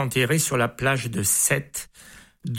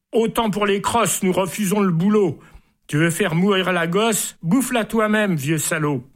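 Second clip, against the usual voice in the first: indignant lions; 5 semitones higher than usual. Acted. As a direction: throw the insult back at the emperor. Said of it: "Ils disent".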